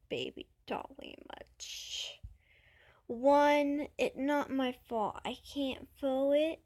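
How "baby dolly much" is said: whisper. Real, not acted. Explaining how her voice sounds.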